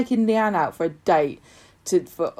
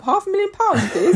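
laughing; 11 semitones higher than usual. Acted. Doctor quoting a huge price.

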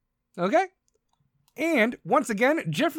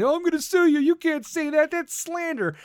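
mock whiny threat; 6 semitones higher than usual. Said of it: "he's gonna be all upset".

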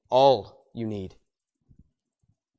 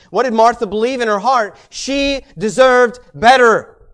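preaching; 9 semitones higher, 9 dB louder than usual. Acted. Preacher driving the point home to the congregation, loud and high. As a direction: build it up from the pulpit.

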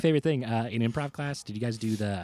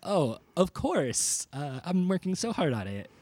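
sarcastic; 8 semitones higher than usual. Retold or imitated. Sarcastic retold reply.

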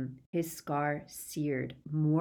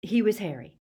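usual speaking voice

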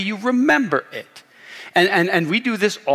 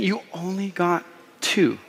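slow, softer mock disappointment; belittling pity; 2 semitones higher, 5 dB quieter than usual. Kid with more gifts to kid with two, belittling. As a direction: drag it out as if two is pitiful.

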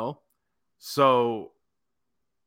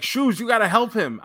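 higher, a touch louder retold yelling; mock yell; 6 semitones higher, 4 dB louder than usual. Broski urging the manager to help, mock yell. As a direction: bark it at him like an impatient partner. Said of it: "Broski's just yelling at me".

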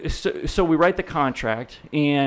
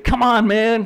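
pushy client; 10 semitones higher, 7 dB louder, slower than usual. Retold or imitated. Pushy client offering vodka.

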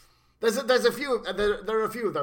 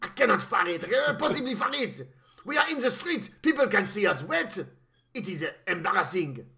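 put-on French accent. French henchman voiced, exclaiming.

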